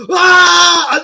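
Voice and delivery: high, loud cry of pain. Retold or imitated. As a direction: cry out when the pain lands. Retelling being hit in the groin.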